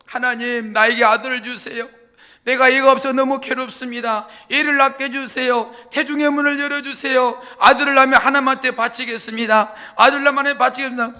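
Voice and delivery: retold woman pleading loudly in prayer. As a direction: beg God for a son, raising the voice. Hannah prays desperately for son.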